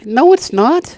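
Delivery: loud protest. Real, not acted. Protesting the embarrassing CB handle.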